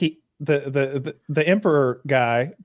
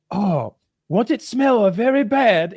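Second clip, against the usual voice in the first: put-on high, slow Japanese-accented lady; mock Japanese lady's careful English; weird Japanese-English; 7 semitones higher, 4 dB louder, slower than usual. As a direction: imitate halting Japanese-accented English, worried and polite. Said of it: "in weird Japanese to English, the lady goes".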